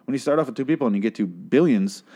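ordinary talk

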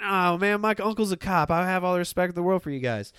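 butter up the cops; mock-earnest sucking up; sweet talking. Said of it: "You know, sweet talking".

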